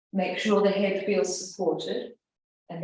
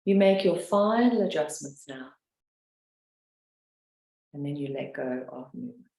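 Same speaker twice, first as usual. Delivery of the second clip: guided meditation; 2 semitones lower than usual. Acted. Leads a guided relaxation.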